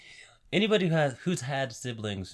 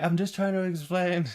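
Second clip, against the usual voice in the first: pleading; 9 semitones higher, 5 dB louder than usual. Retold or imitated. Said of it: "he's like".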